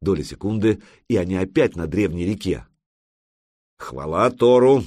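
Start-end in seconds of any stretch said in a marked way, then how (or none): 3.8-4.8 loud, drawn-out cry of praise; exclaiming. A narrator's reading. Ярл praising Thor after getting back, exclaiming. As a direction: proclaim it slowly and forcefully. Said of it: "воскликнул Ярл".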